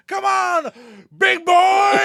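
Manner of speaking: high, loud mock wrestler taunt; cocky shout. Cocky wrestler taunting his opponent, shouted. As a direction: bellow it with cocky swagger. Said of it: "He's so cocky".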